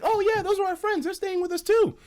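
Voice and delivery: high mock girl voice; imitating the girls. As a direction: play the girls brushing it off. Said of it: "And the girls are like".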